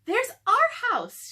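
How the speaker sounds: high, loud and slow, delighted; excited. Acted. Annie spots their house from the treehouse, delighted. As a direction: point it out with glee.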